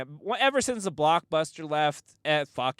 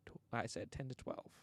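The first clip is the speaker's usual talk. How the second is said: very soft, trailing murmur; quiet reply; 4 semitones lower, 14 dB quieter than usual. Retold or imitated. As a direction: answer softly, as a discreet aside. Quiet exchange beside the rant.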